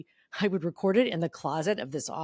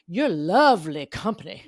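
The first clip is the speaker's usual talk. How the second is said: loud, slow, grand compliment; Rue McClanahan; 6 dB louder than usual. Retold or imitated. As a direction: savor each word like a stage diva. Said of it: "And she goes".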